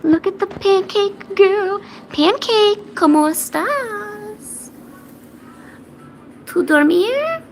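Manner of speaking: very high, drawn-out cooing. Real, not acted. Owner greets the cat in Spanish, high baby talk.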